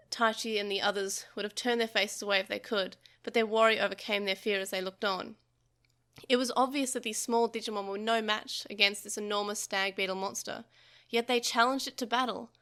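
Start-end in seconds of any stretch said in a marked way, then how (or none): none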